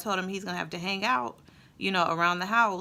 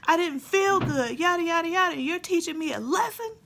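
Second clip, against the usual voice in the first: much higher and louder, whiny protest; son's outraged whine; 12 semitones higher, 4 dB louder than usual. Retold or imitated. Son protesting the lesson to mom, loud high whine. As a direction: wail it like a wronged, hungry kid. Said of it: "he said".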